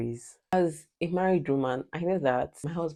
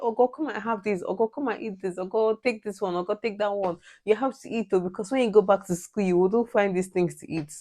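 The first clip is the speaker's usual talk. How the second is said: higher, coaxing African mother pressing food; imitating her mom; 6 semitones higher than usual. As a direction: mimic a mom urging her child to eat. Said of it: "She'll say".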